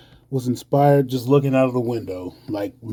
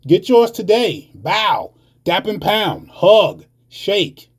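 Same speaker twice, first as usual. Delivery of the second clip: emphatic, higher-pitched poetry performance; spoken-word rant; 5 semitones higher, 4 dB louder than usual. Acted. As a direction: rant it as a poem, punching the key words.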